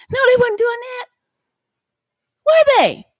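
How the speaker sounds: high-pitched, shouted rebuttal; indignant challenge. Real, not acted. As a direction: fling the question at them, high and loud. Heated rhetorical challenge to rival.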